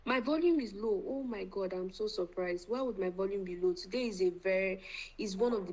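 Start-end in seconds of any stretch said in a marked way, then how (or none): none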